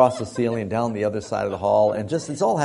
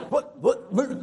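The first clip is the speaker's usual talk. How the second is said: high, sputtering stammer of shock; imitated guttural noises. Son mimics dad finding the mess, guttural sputtering. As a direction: mimic a speechless dad's sputters. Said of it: "make some guttural noises".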